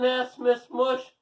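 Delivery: ordinary delivery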